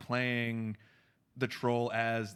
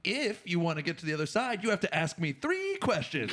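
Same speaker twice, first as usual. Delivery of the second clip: higher, lightly voiced troll; mock-grand riddle keeper; bridge troll; 6 semitones higher than usual. Troll setting terms for the couple, lightly hammed-up. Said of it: "like a bridge troll".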